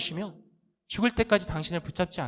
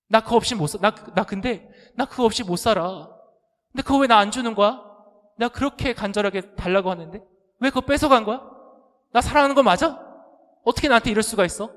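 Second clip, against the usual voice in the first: put-on loud, higher, sulky child's protest; whining child; 5 semitones higher, 5 dB louder than usual. Child accuses parents of not loving, loud whine. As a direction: pout it out like a spoiled kid.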